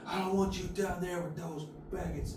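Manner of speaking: slow, hushed, disgusted uncle; uncle's slur. Retold or imitated. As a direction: sneer it out slowly like a bigoted uncle. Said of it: "And he goes".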